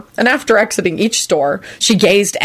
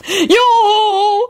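much higher, calling out; screeched yoo-hoo. A narrator's reading. Lucretia hailing the driver, much higher call. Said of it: "Lucretia screeched".

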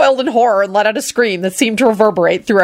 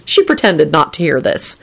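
usual speaking voice

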